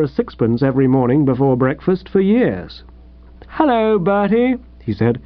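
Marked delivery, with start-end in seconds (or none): 3.5-4.6 radiant greeting. Acted. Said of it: "he was simply radiant".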